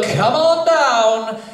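normal talking voice

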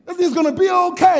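put-on high, loud kid taking charge; confident little kid. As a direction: shout it out like a sure-of-himself child. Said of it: "he's, he's confident".

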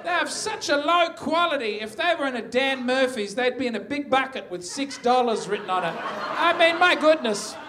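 high-pitched comic indignation; exasperated rant. Acted. Comic rant about low-quality workers.

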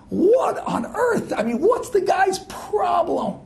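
high, slow, near-crying outburst to his father; exasperated outcry. Retold or imitated. Son telling father about the chase, high, slow and near tears.

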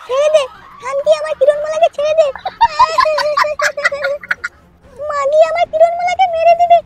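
tearful pleading. Acted. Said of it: "কাঁদছো কেন লাউড়া?".

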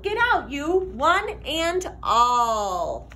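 plain reading voice

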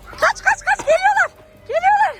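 high, loud frantic rush; excited panic. Pretend. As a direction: squeal as if fleeing. Panicked mock escape with bikes.

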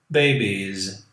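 usual speaking voice

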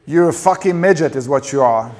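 furious wife shouting him down; shouted insult. A narrator's reading. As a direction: hurl it angrily at your husband.

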